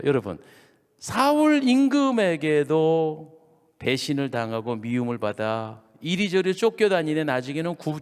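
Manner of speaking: normal talking voice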